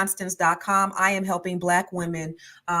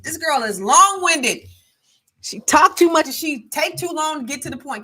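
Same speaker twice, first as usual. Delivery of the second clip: higher, louder mock of mama scolding; imitating mama; 7 semitones higher, 5 dB louder than usual. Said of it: "my mama used to always say".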